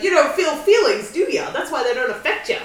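hammed-up taunting character voice; Harry Mudd. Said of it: "he's like".

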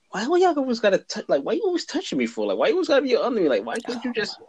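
high, fast exasperated complaint; exasperated retort. Retold or imitated. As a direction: fire it off high and fed up. Annoyed retort to mom.

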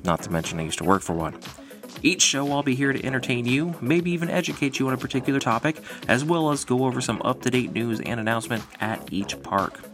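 ringmaster. Acted. Podcast intro, showman welcome.

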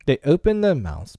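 ordinary delivery, nothing marked

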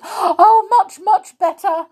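high, loud tearful promise; tearful eagerness. A narrator's reading. Amelia promises Tom she will behave, tearfully eager. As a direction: gush it through storybook tears. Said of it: "wiping her eyes".

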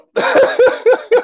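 high, loud burst of laughter; laughing out loud. Real, not acted. Laughing after moving on.

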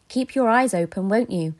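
ordinary delivery

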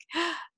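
a sharp, delighted gasp in; excited gasp. Real, not acted. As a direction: gasp with excitement before the name. Gasp before naming the guest.

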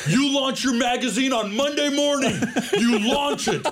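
high, forceful mobster reassurance; full Scorsese. Retold or imitated. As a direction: bellow orders like a tough Italian protector. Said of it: "he goes full Scorsese".

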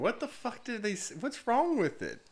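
high-pitched imitation of his girlfriend; mock Courtney. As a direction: play the baffled, annoyed girlfriend. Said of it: "Courtney's like".

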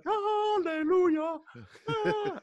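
very high, slow rejoicing cry; mock hallelujah. The friends rejoice when the van of models stops, high and slow. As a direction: sing it out like a miracle arrived.

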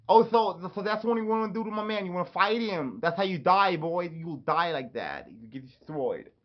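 high, hammed-up tough-guy threat; mock fight threat. Acted challenge to a fight.

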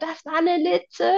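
high, drawn-out call; acted alarmed call. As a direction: call it out high, stretching the words. Acted quote of Cindy calling out.

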